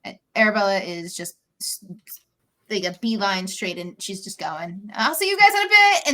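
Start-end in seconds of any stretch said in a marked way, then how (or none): 4.9-6.1 high, loud hurried-off goodbye; pitched-up, loud, panicky farewell; panicked exit. Acted. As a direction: call it out high as you flee. Character fleeing to have panic attack.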